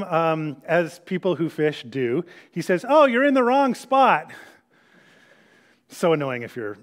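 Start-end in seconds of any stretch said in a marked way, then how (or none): 2.9-4.3 high-pitched mock fisherman's call. Jesus teasing fishermen out in the boat, calling out. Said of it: "he calls out to them".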